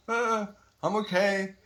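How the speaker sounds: high-pitched put-on "I'm fine" voice; mock cheerful reassurance. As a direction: act out brightly insisting all is okay. Acts out smiling the pain away.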